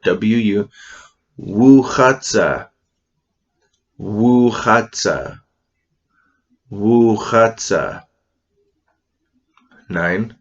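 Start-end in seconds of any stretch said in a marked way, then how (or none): 1.4-8.0 Wuchatsa slow, wu first then chatsa; Kootenai word. Shown as a demonstration. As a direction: stress the wu onset in Wuchatsa. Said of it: "put a little bit of wu in front of it".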